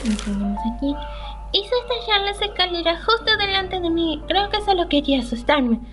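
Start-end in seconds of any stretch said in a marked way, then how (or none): 1.5-5.8 higher-pitched, performed reading of a character's thoughts; game dialogue read-aloud. Acted. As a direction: read the heroine's inner monologue in a lifted voice. Reads the game character's lines aloud.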